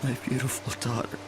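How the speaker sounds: breaking into tears. Acted. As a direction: grief swelling to crying on the last words. Grieving lost wife and daughter.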